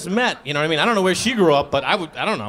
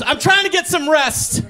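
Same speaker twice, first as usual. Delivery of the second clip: annoyed wife; 8 semitones higher than usual. Retold or imitated. Wife annoyed, half asleep.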